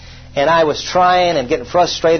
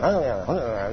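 low, soft grumble; murmuring complaint; 6 semitones lower, 9 dB quieter than usual. Retold or imitated. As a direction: grumble a prayer under your breath. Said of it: "I was murmuring and complaining".

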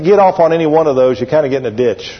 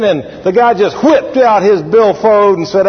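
ordinary delivery

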